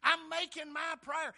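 loud, slow plea to God; voicing David. David calling out to God in a loud, slow plea. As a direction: cry out to God as David, slowly and loudly. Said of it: "he starts out and says".

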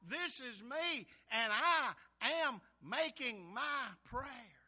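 loud, slow plea to God; voicing David. David calling out to God in a loud, slow plea. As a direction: cry out to God as David, slowly and loudly. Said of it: "he starts out and says".

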